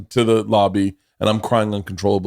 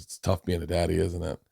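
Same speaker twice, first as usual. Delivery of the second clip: softer, tender hush of a kind stranger; gentle soft quote; 5 semitones lower, 11 dB quieter than usual. Retold or imitated. Whitney comforting the crying dad, softly. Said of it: "And she puts her arm around me and says".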